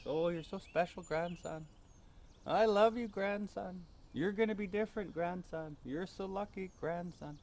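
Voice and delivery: mock-tearful high old lady voice; crying grandmother. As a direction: imitate a weeping granny cherishing her grandson.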